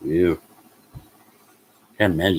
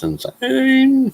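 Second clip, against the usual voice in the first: high, slow drawn-out insane; stretched out disbelief; 5 dB louder than usual. Real, not acted. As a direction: drag out the word in shock. Reacting to weekly rent.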